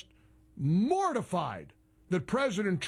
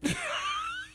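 high-pitched, quick laugh; 3 dB quieter than usual. Real, not acted. Host laughing at the networks' tantrum, high amused laugh. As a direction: burst into a high giggle.

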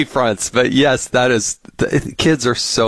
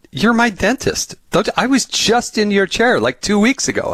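mock indignant; 12 semitones higher than usual. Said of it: "he get mad at me".

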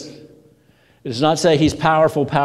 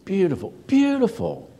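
intensifying repetition; 8 semitones higher than usual. Acted. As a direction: repeat it higher, in hushed wonder. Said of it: "they would repeat the phrase".

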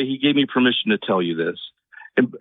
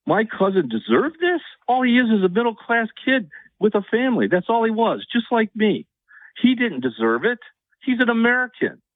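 high, raised, indignant voice; upset; 8 semitones higher than usual. Real, not acted. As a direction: pitch climbing with disbelief. Caller's outrage about cousin.

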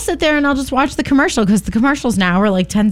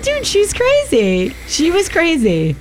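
exasperated disbelief; 7 semitones higher, slower than usual. Real, not acted. Exasperated about her mother.

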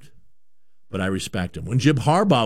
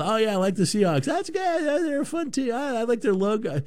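much higher, fast, gushing fan voice; mock casual fan; 10 semitones higher than usual. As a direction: parody a naive bandwagon fan, squeaky and quick. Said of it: "Now it's like".

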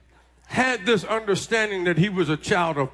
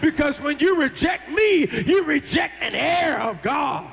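high, ringing preacher's cry; full-force preaching; 5 semitones higher than usual. Acted. Preacher to congregation, rising full-force preaching. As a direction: lift the church with it.

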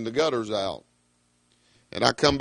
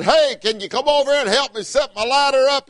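hollering; 10 semitones higher, 3 dB louder than usual. Retold or imitated. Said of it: "I hollered over".

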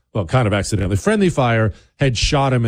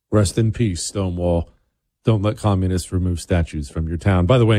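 ordinary talk, nothing marked

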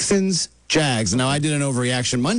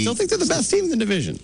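high-pitched bold claim; higher register; 11 semitones higher than usual. Pretend. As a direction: voice shot way up high. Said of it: "Kinda think you could do better at it".

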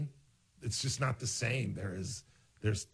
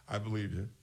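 ordinary delivery

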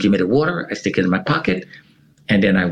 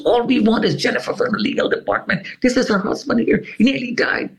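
Albert pleading; 11 semitones higher than usual. Retold or imitated. Albert pleading with security guards.

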